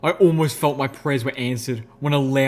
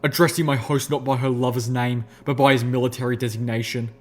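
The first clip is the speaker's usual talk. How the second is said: usual speaking voice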